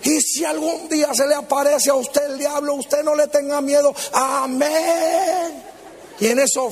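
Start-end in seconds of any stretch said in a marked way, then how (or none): 0.0-3.9 higher-pitched imitation of a revival preacher; acting out a preacher in full cry; fiery preacher. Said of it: "predicando así con poder y fuego". 4.1-5.6 high, drawn-out mock amen; long held crowd response; congregation's cry. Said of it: "toda la iglesia gritaba".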